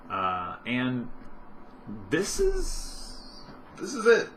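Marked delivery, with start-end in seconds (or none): 2.1-4.3 mock dramatic. Real, not acted. Admitting his quiet Friday night.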